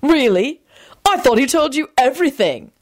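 mocking. A narrator's reading. George goading Quentin about being told everything, mocking. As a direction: needle him with feigned disbelief. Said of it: "he responded mockingly".

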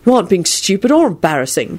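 mildly heated contradiction; a touch of indignation. A narrator's reading. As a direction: insist on it in their defence. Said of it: "George said heatedly".

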